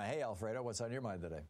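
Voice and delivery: usual speaking voice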